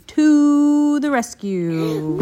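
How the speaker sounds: high, greatly drawn-out words; slow, swelling, loud climax; triumphant finish. A narrator's reading. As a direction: stretch it out to a big finale. Triumphant ending of story.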